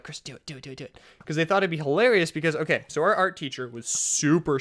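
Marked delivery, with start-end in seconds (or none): 0.0-1.0 very soft, urgent repeated prompt; hushed egging-on. Retold or imitated. Kids secretly urge Chris to spray in class, hushed. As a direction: imitate classmates urging under their breath.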